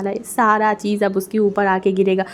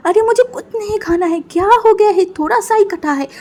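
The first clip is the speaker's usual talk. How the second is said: put-on higher boy's voice, dismissive; child voice; 10 semitones higher, 5 dB louder than usual. Injured boy telling his mother it's nothing, higher. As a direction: play a kid brushing off worry.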